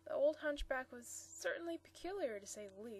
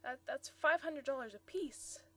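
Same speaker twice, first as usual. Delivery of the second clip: hurried; 3 semitones higher, 9 dB louder than usual. Acted. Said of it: "Mister Sproul said hurriedly".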